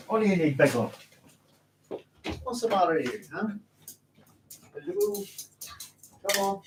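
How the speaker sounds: high, soft pet talk; doggy voice for a dancing pup. Real, not acted. Talking to his dog.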